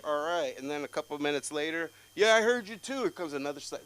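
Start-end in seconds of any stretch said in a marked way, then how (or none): 2.2-3.0 high, loud accusing yell; loud, pitched-up reprimand; scolding shout. Retold or imitated. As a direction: holler it at a misbehaving kid. Another relative joins the scolding.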